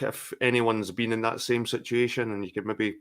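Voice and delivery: ordinary talk, nothing marked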